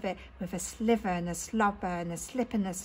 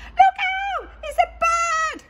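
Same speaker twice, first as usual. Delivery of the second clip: high, loud panicked shout of warning; panicked cry; 6 dB louder than usual. Acted. Snails warning each other of a bird, high panicked shout. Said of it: "cried the snails in panic".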